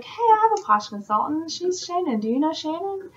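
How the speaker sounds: high-pitched imitated customer; acted friendly referral. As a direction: pipe up as someone recommending a friend. Voices someone recommending their consultant.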